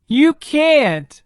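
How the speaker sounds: put-on boy's high urgent cry; a boy pleading in alarm. A narrator's reading. Boy protests to the dragon, high urgent cry. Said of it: "cried the boy".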